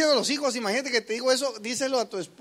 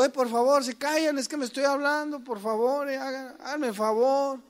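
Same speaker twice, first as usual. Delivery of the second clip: meek pleading; 6 semitones higher than usual. Retold or imitated. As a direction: beg them politely and weakly. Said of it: "Porque si le digo".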